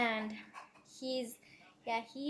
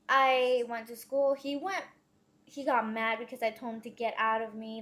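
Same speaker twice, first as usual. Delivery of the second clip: ordinary talk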